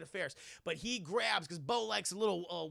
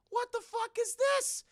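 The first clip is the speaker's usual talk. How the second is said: very high-pitched disbelief; incredulous mock outburst; 4 dB louder than usual. Storyteller on Hope's surprise phone call, incredulous. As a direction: squeal the question in bafflement.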